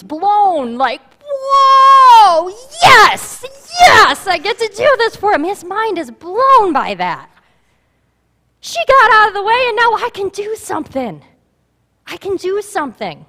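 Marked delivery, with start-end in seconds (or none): 1.2-5.4 high, loud overjoyed shout; God voiced as thrilled and overjoyed; thrilled cry. 8.6-13.2 eager god voice. Pretend.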